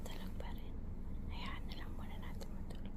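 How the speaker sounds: hushed whisper, don't wake him. Real, not acted. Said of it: "nakatulog na siya".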